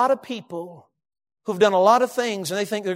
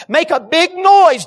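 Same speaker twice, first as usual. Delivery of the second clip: mocking the showy givers; 6 semitones higher, 6 dB louder, slower than usual. Mocking the Pharisees' showy giving.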